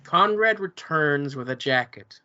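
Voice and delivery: ordinary delivery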